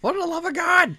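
mock exasperation. Joining the retold yell.

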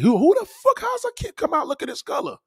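very high, loud shocked squeal; shocked shriek. Retold or imitated. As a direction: shriek in disbelief. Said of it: "They're like".

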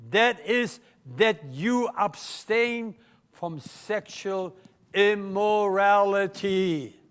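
slow, weighty verse reading; solemn scripture reading. Acted. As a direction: read each phrase slowly and firmly. Reads scripture slowly.

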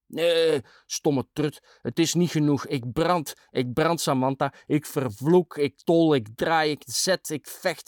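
high-pitched defiant tirade; drunken cursing, worked up; furious rant. A narrator's reading.

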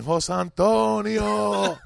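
long, high call across the park; mother calling out. Retold or imitated. Mother calling her son from down the corner, loud and high. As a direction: call his full name from far off.

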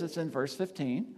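ordinary talk, nothing marked

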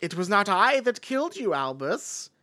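grand theatrical stage delivery; Shakespearean actor. Retold or imitated. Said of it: "not how Lupin talks".

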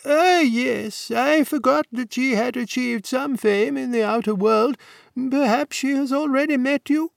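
a hint of a genial old don; elderly warden. A narrator's reading. Warden voiced by reader.

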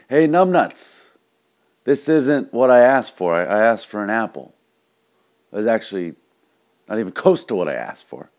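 loud, high mock outrage easing toward the end; irate customer. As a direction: bellow a complaint at the bartender, then settle. Drunk customer berates bartender.

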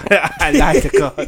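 laughing mimicry, high and slow. Mimicking a friend's odd line through laughter.

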